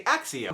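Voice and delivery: "Axio" pronounced like k then s; audiobook pronunciation. Shown as a demonstration. Showing how the audiobooks say the spell, "Axio" with ks. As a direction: say "Axio" with a ks sound, AK-see-oh. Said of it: "the audiobooks".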